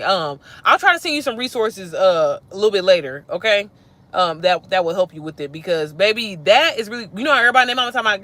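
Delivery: usual speaking voice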